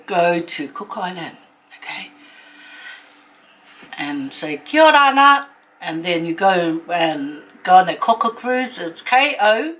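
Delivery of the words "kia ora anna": loud, higher hailing call; called-out greeting. Shown as a demonstration. Visitor arriving in Cook Island, calling out hello. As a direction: call the greeting out brightly to someone over there.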